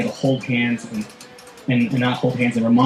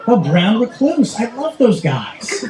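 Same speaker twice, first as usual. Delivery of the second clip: much higher, slowed, casually delighted; mock-cheerful guy with a tarantula on his face; unfazed guy; 8 semitones higher, slower than usual. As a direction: imitate a man cheerfully unbothered by spiders. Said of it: "He goes".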